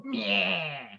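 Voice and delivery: low, dismissive grunt; aggressive meh. Acted. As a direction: spit out a curt meh. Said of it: "I aggressively go".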